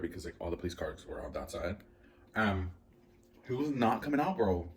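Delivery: ordinary talk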